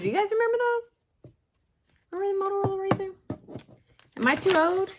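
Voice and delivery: excited. Real, not acted. Excited nostalgia.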